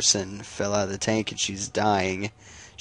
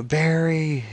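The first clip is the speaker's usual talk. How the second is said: high, stretched-out cry at modest volume; mock scream; 7 semitones higher than usual. Batman cries out for his burning friend, mock scream. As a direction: imitate a screamed name without full force. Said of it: "Batman just screaming".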